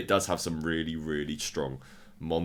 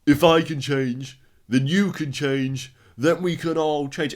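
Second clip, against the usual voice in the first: loud, high, slow mock victory speech; Rocky; 8 semitones higher, 7 dB louder, slower than usual. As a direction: proclaim it like a hammed-up movie boxer addressing the crowd. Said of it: "he stands up and goes".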